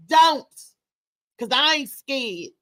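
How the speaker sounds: normal talking voice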